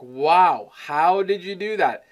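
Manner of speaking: impressed wow, punched up; imitating amazed clients. Client reacting to the finished work, amazed. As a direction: mimic a client who is blown away. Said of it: "They're blown away by it".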